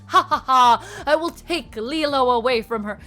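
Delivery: loud, hammy evil villain laugh; cackling villain. Pretend. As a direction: play a cartoon villain gloating. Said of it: "cackling in the background like".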